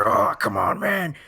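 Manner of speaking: loud, exasperated mock complaint; hammed-up annoyed outburst; imitated yelling. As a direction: imitate a character yelling in protest. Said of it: "And yelling, he's like".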